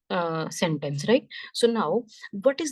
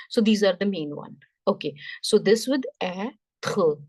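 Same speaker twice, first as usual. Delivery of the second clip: usual speaking voice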